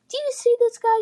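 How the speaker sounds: loud, high jeer to the crowd; high mocking call. Bully calling to his goons, loud high jeer.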